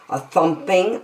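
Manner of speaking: ordinary delivery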